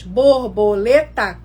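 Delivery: "Borboleta" said slowly, each syllable separate, for counting. Shown as a demonstration. Teacher counting syllables for parents, "Borboleta" said slowly and split into syllables. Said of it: "a gente vai contar quantas sílabas tem".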